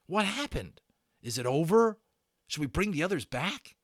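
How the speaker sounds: put-on high, breathless frightened boy; Patrick babbling. A narrator's reading. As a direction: pour it out like a panicked kid. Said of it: "Patrick babbling to Omri".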